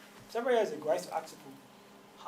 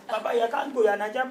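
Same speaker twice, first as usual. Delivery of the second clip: pleading; 7 semitones higher, 14 dB louder than usual. Retold or imitated.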